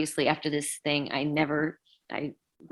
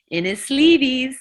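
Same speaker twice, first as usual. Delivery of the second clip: punchline; 8 semitones higher, 8 dB louder than usual. Retold or imitated. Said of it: "And he goes".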